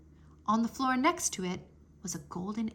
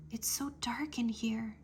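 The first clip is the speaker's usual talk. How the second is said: hushed unvoiced whisper; 6 dB quieter than usual. A narrator's reading. Boy whispering in a dark room. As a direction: breathe it into the dark. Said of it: "Drake whispered".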